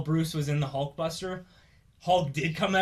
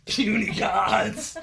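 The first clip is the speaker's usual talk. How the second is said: slow, raised monster taunt; Hulk; 5 semitones higher, 4 dB louder, much slower than usual. Retold or imitated. Host quotes Hulk after beating gods, slow brutish taunt. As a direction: drag it out as a big brute's put-down. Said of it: "Hulk was just like".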